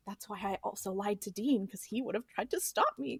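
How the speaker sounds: soft, low-key retold admission; furtive confession. Recapping Sam's lie to Dean, soft furtive confession. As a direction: say it softly, like owning up to a secret lie. Sam secretly lying, retold.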